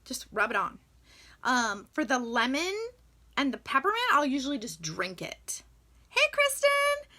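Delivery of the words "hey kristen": high, loud call across the house; sing-song call to Kristen. Real, not acted. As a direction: shout her name to get her attention. Calling out to someone off-camera.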